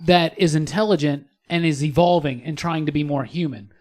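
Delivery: ordinary talk, nothing marked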